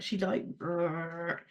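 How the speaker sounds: rolled lips in a slow purring trill; a slow, rumbling imitation of a purring cat; imitated purr. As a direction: mimic a cat's purr, drawn out. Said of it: "She purrs like".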